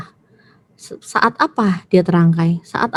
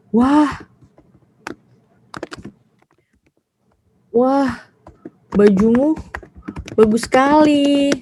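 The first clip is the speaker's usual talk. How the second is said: slow, higher admiring exclamation; admiring praise; 5 semitones higher, slower than usual. Shown as a demonstration. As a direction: gush a compliment, drawn out, as a demo. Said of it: "Ekspresi memuji gitu kan". (memuji dengan kagum)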